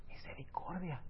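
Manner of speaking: breathy whisper of the key word; hushed reverent whisper. Acted. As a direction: drop to a hushed whisper on Misericordia. Quiet key word in sermon.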